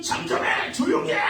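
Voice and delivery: high-pitched acted hushing yell; crowd's loud shout. Crowd silencing the blind men, acted loud shout. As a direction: shout them down like an angry crowd. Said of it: "무리들이 막 큰 소리를 할 때에".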